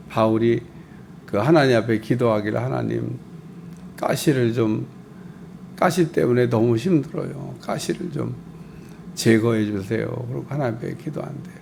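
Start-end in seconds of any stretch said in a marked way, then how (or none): 2.6-10.1 낮고 작게 호소하는 기도; 힘겹게 애원하듯; 간절한 기도. Retold or imitated. 바울의 기도를 재현. (가시 때문에 괴로운 바울이 작게 간구함)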